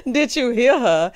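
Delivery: loud laughing mimicry; mocking through laughter. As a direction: repeat the question mockingly, cracking up. Mocks the quote while laughing.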